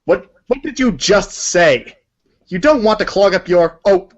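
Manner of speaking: usual speaking voice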